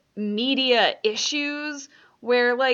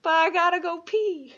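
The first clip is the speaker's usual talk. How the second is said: put-on little kid; 8 semitones higher than usual. Quoting a kid being taught about warriors, little kid voice. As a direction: imitate a small kid piping up. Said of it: "and then one kid's like".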